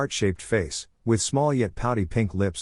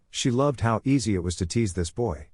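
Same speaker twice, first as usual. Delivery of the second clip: ordinary delivery, nothing marked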